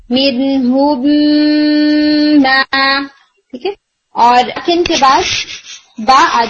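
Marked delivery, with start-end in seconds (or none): 0.1-2.6 mim held and merged into the next mim; لمبی ٹھہری ہوئی میم کا ادغام; idgham demo. Shown as a demonstration. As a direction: hold the merged mim a beat longer. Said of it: "ادغام کر کے زیادہ دیر ٹھہر کے پھر پڑھتے ہیں".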